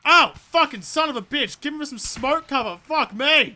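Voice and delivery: high, loud burst of swearing; alarmed cursing. Real, not acted. Player under fire begging for smoke cover, alarmed cursing. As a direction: cry out as if just hit.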